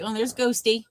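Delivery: ordinary talk, nothing marked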